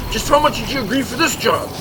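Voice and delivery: ordinary delivery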